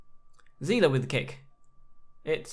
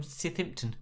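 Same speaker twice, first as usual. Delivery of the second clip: softer, South African-tinged pronunciation of Southampton; South African accent; 3 semitones higher, 7 dB quieter than usual. Real, not acted. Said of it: "I went South African there".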